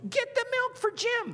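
very high squeaky wife; tiny little voice. Retold or imitated. Tiny wife ordering her husband about, high squeaky voice. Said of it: "this tiny little voice".

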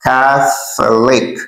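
slow teaching CATH, then LIC; syllable-by-syllable model. Shown as a demonstration. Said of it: "we say".

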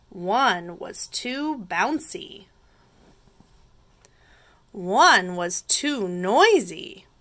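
expressive storytime voice; picture-book narration. A narrator's reading. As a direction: lean on key words, drawing them out. Reading picture book aloud.

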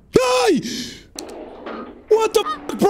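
sudden high shriek; scream. Real, not acted. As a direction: shriek it in fright. Jump scare in the game.

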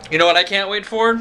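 usual speaking voice

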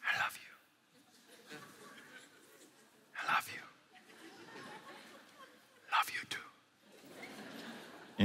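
soft, breathy whisper of love. Shown as a demonstration. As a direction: whisper it close to your lover. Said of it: "Lovers whisper".

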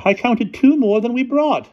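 very high, loud falsetto; puzzled headmistress. A narrator's reading. Headmistress comments to the driver, puzzled falsetto. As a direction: remark in a piercingly high ladylike voice.

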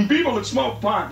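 ordinary delivery

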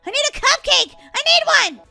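high, loud tantrum-like plea; desperate demand. Acted. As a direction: wail for a treat you must have. Begging at closed shop.

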